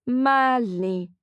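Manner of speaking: strange, wrong-sounding call voice; off robotic tone. Acted. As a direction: say his name like something's broken. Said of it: "Her tone is off".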